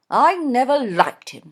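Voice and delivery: loud, firm mother; firm Mrs Norbury. A narrator's reading. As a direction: flatly insist as the stubborn mother. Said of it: "said Missus Norbury firmly".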